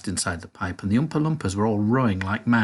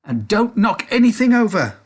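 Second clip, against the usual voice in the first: very high loud warning; shouted; 10 semitones higher, 6 dB louder than usual. A narrator's reading. Wonka shouts at the children, high loud warning. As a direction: stop them with a sharp call. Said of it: "shouted Mister Wonka".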